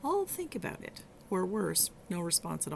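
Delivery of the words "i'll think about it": high, polite brush-off imitation; imitating a polite put-off in a much higher voice. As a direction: mimic a noncommittal client who won't commit. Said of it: "I often got a polite".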